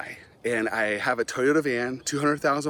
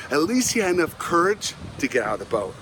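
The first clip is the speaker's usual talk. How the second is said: ordinary delivery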